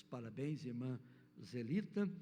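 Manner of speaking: ordinary delivery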